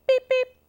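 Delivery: high, loud electronic beeps; short high twin beep; imitated button beeps. A narrator's reading. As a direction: beep twice like a control panel.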